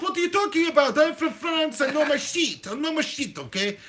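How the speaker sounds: high-pitched indignant Frenchman; hammed-up French outrage; French accent. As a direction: protest in a thick comic French accent. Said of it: "we speak like this".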